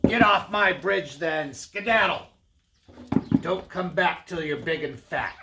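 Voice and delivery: gruff troll dismissal. Acted. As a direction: shoo the goat off the bridge as the troll. Troll dismisses middle goat.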